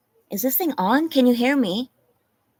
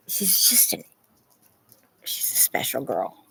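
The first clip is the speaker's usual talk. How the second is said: whispered aside; 2 semitones lower, 4 dB quieter than usual. Real, not acted. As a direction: whisper it fondly to the viewers. Aside about the dog.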